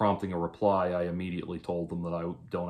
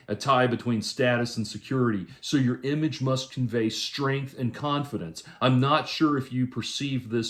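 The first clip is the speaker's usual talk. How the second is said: raised, emphatic read-out of someone's advice; higher-pitched, insistent quote reading; reading a message aloud; 3 semitones higher than usual. Retold or imitated. Reads out a message.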